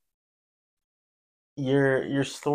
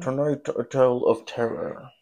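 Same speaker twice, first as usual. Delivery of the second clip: vintage horror narrator. Acted. Introducing her scary story.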